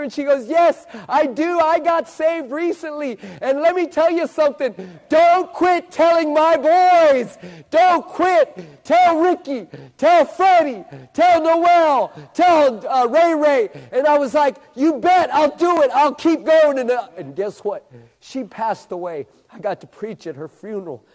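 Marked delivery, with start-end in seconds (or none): 0.5-3.2 higher, slow, drawn-out affirmation; emphatic reply. Retold or imitated. Little lady answers the street preacher, emphatic and high. 5.1-13.7 loud, high, punched-out urging; impassioned plea. Retold or imitated. Mother begs the street preacher to tell her boys, loud and impassioned. 14.7-16.8 loud, high, rousing pledge; fervent promise. Retold or imitated. Preacher promises the mother he'll keep going, loud and fervent.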